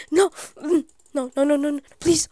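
loud, high burst of panic; panicked pleading. Real, not acted. Player caught by Piggy in the game, panicked. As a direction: blurt it out as danger closes in.

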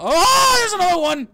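very high alarmed yell; panicked shout. Real, not acted. Spots another monster.

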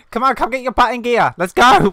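high, very loud hurrying order; urgent shout. Real, not acted. Player rushes friend before day ends, urgent shouting. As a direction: shout to get them moving fast. Rushing friend as time runs out.

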